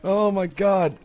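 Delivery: high, helpless laughing delivery. Real, not acted. Gamer laughing at how bad their scream sounded, laughing.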